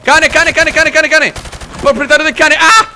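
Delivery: loud, high, rapid-fire alarm; panicked shout. Real, not acted. Player attacked by a dog, panicked shouting.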